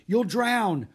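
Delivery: loud, high warning shout. A narrator's reading. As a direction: yell the danger at her. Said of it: "he shouted".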